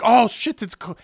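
high, loud outburst; worked-up film star swearing; Bela Lugosi. Acted. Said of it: "Bela Lugosi's like".

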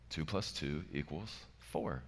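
soft matter-of-fact answer; simply, calmly. Shown as a demonstration. Pastor showing the calm answer to two plus two, softly matter-of-fact. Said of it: "Very simply, they would respond".

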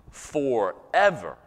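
"forever" stretched slowly between pauses; stress on FOREVER. Real, not acted. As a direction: draw out "forever" and set it apart. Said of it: "put emphasis on the words forever".

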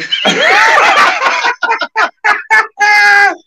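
high, loud belly laugh; loud cackle. Real, not acted. Cracking up at friend's episode-naming one-liner, loud cackle. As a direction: burst into a long high cackle.

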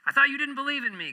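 high, fast, teasing voice of God; God's voice. Acted. God teases the atheist who just prayed, high and fast. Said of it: "God says".

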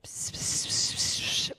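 hissing whispered psst in the ear; nasty little voice. Retold or imitated. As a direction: hiss psst like a tempter. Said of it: "that nasty little voice comes in my ear".

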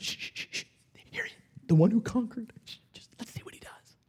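hushed shushing whisper. Pretend. Heaven's crowd hushing each other, whispered. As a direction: whisper as if not to disturb the scene.

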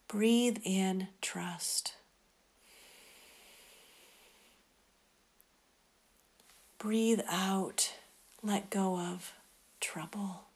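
softly whispered, slow breath prayer; whispering. Acted.

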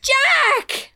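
high, loud shocked cry; shocked outcry. Retold or imitated. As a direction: shout it out in horror at the news. Quoting a shocked fairy line.

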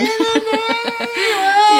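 high, squealing giggle fit; giddy laugh. Real, not acted. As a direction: break into a high, teary laugh. Imagines taking over rock show.

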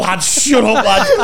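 high, loud mock yell; loud retold shout. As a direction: shout it at your mate. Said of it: "just going".

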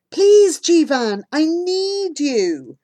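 high, slow plea; pleading with a friend, slow and high. A narrator's reading. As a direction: beg him to stay with you.

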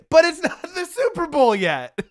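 laughing. Real, not acted. Laughing at the early release.

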